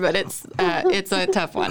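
choking up as you speak; voice cracking with tears. Real, not acted. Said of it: "I'm trying not to cry".

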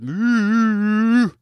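high, stretched-out moo; cow's moo. A narrator's reading. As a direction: hold a long high moo. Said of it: "It came out as".

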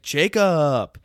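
loud, high, drawn-out hail; hammed-up name call; imitated calling out. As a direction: holler the name across to a friend. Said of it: "He's like".

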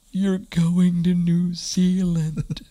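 high, slow mock wail; imitating bawling. Wife emerging from prayer in tears, mock wailing. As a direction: stretch it out like a retold sob. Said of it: "she comes out and she's bawling".

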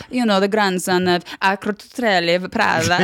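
Italian name-dropper, rolling out the name; Italian-accented fashion insider. Retold or imitated. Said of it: "an impression of him".

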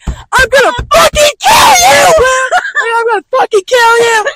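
screaming threats at the door; high, loud raging yell; furious shout. Retold or imitated. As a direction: yell it like you want to kill him.

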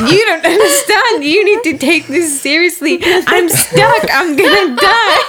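high, loud, laughing mock panic; freaking out. Telling friends she is stuck, hammed-up laughing panic. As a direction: ham up the panic, half laughing. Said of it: "completely freaking out".

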